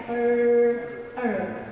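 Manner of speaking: "er" held level with a curled tongue; American R. Shown as a demonstration. Teacher models the rough American sound, American R. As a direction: sound "er" without overfilling the R. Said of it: "Do not overfill it".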